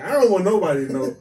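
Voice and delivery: ordinary talk, nothing marked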